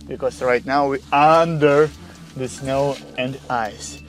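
ordinary talk

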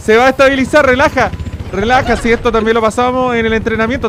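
frantic loud calming. Real, not acted. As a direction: shout reassurance at yourself. Steadying the crashing helicopter.

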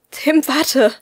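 high, loud call from behind. A narrator's reading. Stegi calls after Tim as he storms out, loud and high. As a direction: shout after someone running off. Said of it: "rief Stegi hinter mir".

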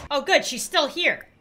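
ordinary delivery

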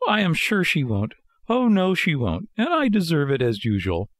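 high-pitched puppet voice, full of self-reproach; high, anxious self-scolding; remorseful Pinocchio. Acted. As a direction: fret aloud as a guilty little marionette.

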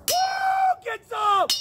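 high pitched hype whoop; cheering whoop. Real, not acted. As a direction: whoop like a rally cry. Cheering himself on.

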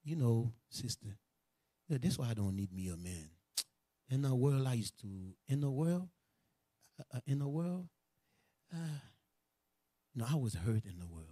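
low, soft, halting confession; hurt confiding. Retold or imitated. Preacher plays a sister confiding old hurt, subdued and halting. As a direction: act out a reluctant, subdued confidence.

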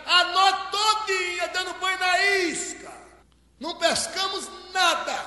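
high, carrying call back from afar; shouted reply. Acted. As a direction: holler the answer across water. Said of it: "Eles gritaram".

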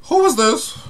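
high-pitched mock shock at a stranger; shocked disbelief. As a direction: act stunned, like you've never seen this face. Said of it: "you be like".

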